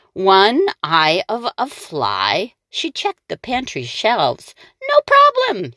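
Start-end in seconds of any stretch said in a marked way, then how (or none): 4.9-5.7 high, louder witch brushing it off. Acted. The witch checking her pantry, high and breezy. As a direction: toss it off like a cocky witch. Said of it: "said Gritch".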